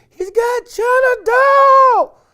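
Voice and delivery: high, loud, slow panicked shout; panicked scream. Retold or imitated. Wife screaming that the lion has the daughter, panicked shout. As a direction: scream it in terror.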